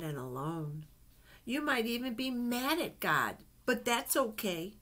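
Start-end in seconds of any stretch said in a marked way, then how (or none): none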